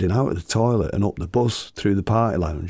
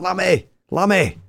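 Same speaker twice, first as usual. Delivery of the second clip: much higher, calling a name down the bus; 10 semitones higher than usual. Retold or imitated. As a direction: yell for Lammy to come help. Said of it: "So I started shouting".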